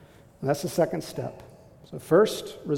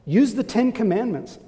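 usual speaking voice

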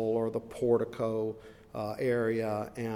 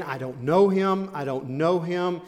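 insistent denial; 5 semitones higher, 7 dB louder than usual. Acted. Peter denies knowing Jesus.

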